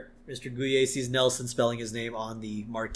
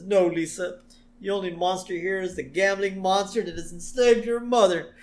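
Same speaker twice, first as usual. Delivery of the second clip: 1 mock tearful Homer; 8 semitones higher, 5 dB louder than usual. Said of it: "Homer starts crying".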